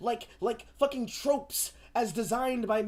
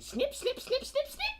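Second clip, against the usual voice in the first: high mock snipping; slower than usual. Mocking censors cutting.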